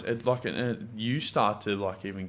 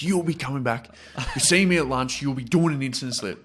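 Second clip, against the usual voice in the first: higher, louder, bossy teacher laying down punishment; stern teacher; 5 semitones higher, 7 dB louder than usual. Retold or imitated. Teacher punishing the student for swearing, stern raised voice. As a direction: hand down the detention firmly. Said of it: "And she goes".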